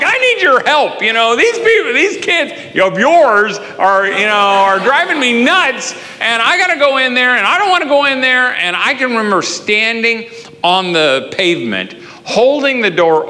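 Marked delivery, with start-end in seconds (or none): 0.0-8.6 high, loud, frazzled dad at the end of his rope; exasperated pleading. Retold or imitated. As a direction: plead for help, near losing it. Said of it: "now I'm really starting to lose it".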